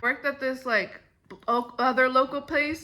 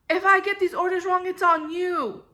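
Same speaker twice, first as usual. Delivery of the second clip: higher, louder imitation of a blaming boss; retold yell, pitched up and pushed; mock yelling; 5 semitones higher, 4 dB louder than usual. Manager blaming worker for wrong orders, mock yelling. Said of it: "she yelled at me".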